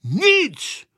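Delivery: high, loud, strict refusal; stern reply. A narrator's reading. Grandpa refusing the impatient boy, stern. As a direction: shut the question down sternly. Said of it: "odvětil přísně dědeček".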